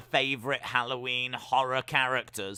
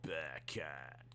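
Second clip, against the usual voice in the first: whisper; 12 semitones lower, 16 dB quieter than usual. Acted. Whispering wrestler's name.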